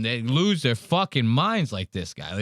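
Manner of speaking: normal talking voice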